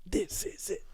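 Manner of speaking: breathy near-whisper, in awe; hushed echo. Real, not acted. Echoing the host's line to build the hype, hushed. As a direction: echo it back under your breath.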